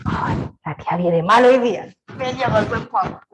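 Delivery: low mock complaint of children; kids grumbling. Teacher voicing children about a stern teacher. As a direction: grumble like a wary pupil. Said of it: "Y los niños dicen".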